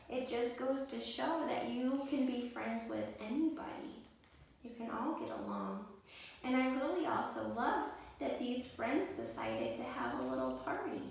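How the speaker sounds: ordinary delivery, nothing marked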